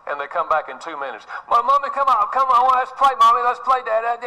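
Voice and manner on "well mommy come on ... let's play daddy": put-on high, eager little-kid voice pleading to play; small child begging. Three-year-old begging mommy and daddy to come play, high and eager. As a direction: chirp it out like a toddler tugging at a parent.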